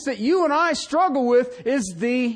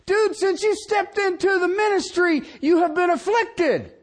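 full-voice pulpit shout; preaching shout; 9 semitones higher than usual. Real, not acted. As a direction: hammer it home to the pastors. Preacher calls out to ministers.

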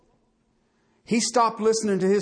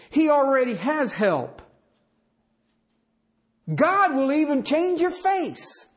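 fervent preaching; 3 semitones higher than usual. Acted. Preaching at full force.

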